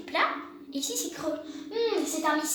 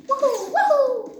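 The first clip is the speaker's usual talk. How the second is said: excited cheer; 9 semitones higher, 9 dB louder, slower than usual. Real, not acted. Cheering while playing.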